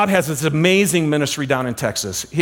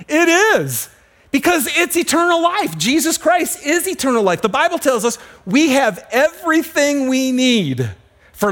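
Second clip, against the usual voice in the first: high, ringing preacher's proclamation; full-force preaching; 7 semitones higher than usual. Acted. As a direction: proclaim it with rising fervor.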